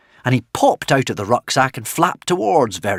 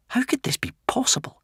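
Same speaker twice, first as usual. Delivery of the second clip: breathless, whispered wonder; barely believing it, hushed; stunned whisper; 4 dB quieter than usual. A narrator's reading. Hiccup amazed at the cheering crowd, whispered.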